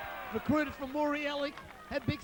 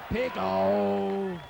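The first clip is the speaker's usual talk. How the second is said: lower, loud call echoing the first; cheering shout; 5 semitones lower, 4 dB louder than usual. Real, not acted. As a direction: call his nickname again, lower. Calling out to a player.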